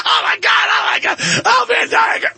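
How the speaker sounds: very high, laughing shout; mock hysterical glee. Host parodying Harris, very high shrieking glee. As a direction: shriek with fake delight. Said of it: "She's like".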